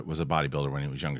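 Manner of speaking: ordinary delivery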